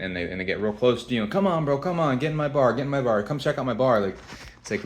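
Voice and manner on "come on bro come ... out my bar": imitated pushy tout, higher-pitched; insistent mock hustler pestering a passerby. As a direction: badger like a bar tout in a raised pitch. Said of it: "they're really pushy and".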